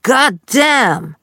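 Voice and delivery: retold Southern-drawled God damn, high and loud; loud Southern curse. Dad cursing from his bedroom, loud Southern oath. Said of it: "hörte ich ein lautes".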